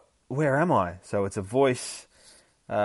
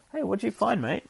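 much higher, sing-song pet voice; talking to the dog; 12 semitones higher than usual. Real, not acted. Streamer turns to his dog, high pet-talk voice. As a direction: coo it at the dog.

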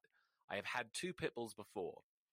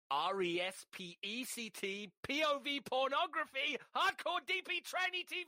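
escalating rant; 6 dB louder than usual. Acted. Escalating absurd list.

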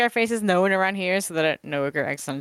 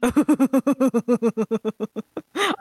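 long, high string of chuckles; drawn-out self-satisfied giggle; smug chuckle; 6 semitones higher, 3 dB louder than usual. Acted. Player pleased at showing off the painting, smug laugh.

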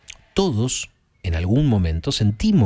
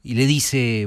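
usual speaking voice

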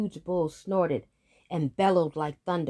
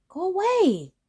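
high, loud shout to go; 14 semitones higher, 7 dB louder than usual. Acted. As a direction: yell him away. Boy sends heifer away.

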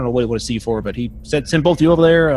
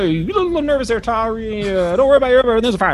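high, loud falsely reassuring; Buzz the steward; 9 semitones higher, 3 dB louder than usual. Acted. Steward reassures nervous Tari, too loudly. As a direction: cover nerves with cheer.

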